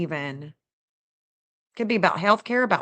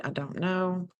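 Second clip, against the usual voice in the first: whispered aside; 6 dB quieter, slower than usual. Real, not acted. Unsure aside while searching news.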